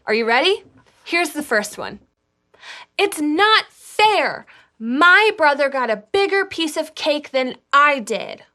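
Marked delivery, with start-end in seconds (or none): 3.0-8.4 whiny voice. Acted. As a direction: whine it like a sulky child. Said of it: "we have to do them in our whiniest voices".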